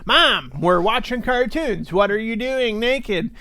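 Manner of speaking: put-on whiny kid voice. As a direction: complain like a kid. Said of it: "your friend's like".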